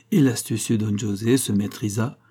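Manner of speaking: ordinary delivery, nothing marked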